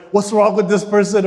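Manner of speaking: fed up, raise your voice at them; upset at spouse. Retold or imitated. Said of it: "You're getting upset".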